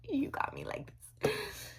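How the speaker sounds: slow breathy whisper. Real, not acted. Thanking Mac setting spray in a slow sultry whisper.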